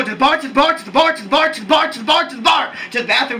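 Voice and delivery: rapid-fire looping chant; hyped, breakneck repetition; really fast. Shown as a demonstration. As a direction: rattle the phrase off over and over, nonstop. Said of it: "I go really, really fast".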